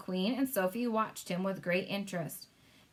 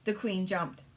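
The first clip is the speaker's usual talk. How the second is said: plain reading voice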